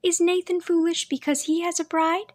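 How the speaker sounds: put-on high, innocent little girl; young girl voice. A narrator's reading. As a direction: ask it like a puzzled young girl. Girl's question.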